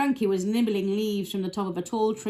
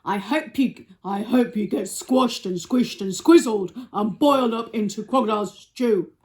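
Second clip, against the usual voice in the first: Trunky; 2 semitones higher, 7 dB louder than usual. Acted. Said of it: "cried Trunky".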